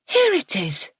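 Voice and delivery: breathless high mother's voice; slightly out of breath. A narrator's reading. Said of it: "she sounds breathless".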